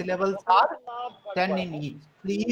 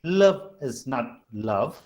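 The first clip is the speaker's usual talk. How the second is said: low, measured reading of verse in feet; lowered voice, scanning the lines foot by foot; metrical verse reading; 6 semitones lower than usual. Shown as a demonstration. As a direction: read the poem lower, pausing between feet. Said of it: "this will be read as this".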